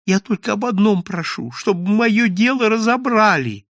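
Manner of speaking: high, meek begging voice; small pleading character voice; timid plea. A narrator's reading. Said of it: "Робкий голос Филюрина".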